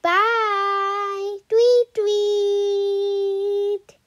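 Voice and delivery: high, sing-song tweets; sung bird chirps. Acted. Bird flying off singing.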